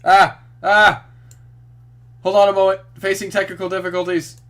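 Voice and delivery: loud high panicked yelps; panicked shouting. Real, not acted. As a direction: yell in a panic. Technical difficulties, panicking.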